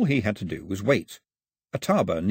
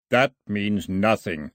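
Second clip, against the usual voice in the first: slow, solemn intoning; intoned; 2 semitones lower, slower than usual. A narrator's reading. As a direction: pronounce it like a ruling. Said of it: "Milo intoned".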